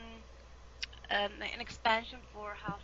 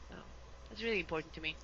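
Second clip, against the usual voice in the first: usual speaking voice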